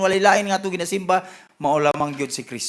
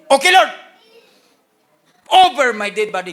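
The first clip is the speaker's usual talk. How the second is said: loud, emphatic burst of boldness; bold declaration; 5 semitones higher, 7 dB louder than usual. Acted. Preacher voices restored Peter answering the Lord, boldly. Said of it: "nahimo ni siyang bold".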